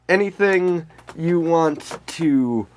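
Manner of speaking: ordinary talk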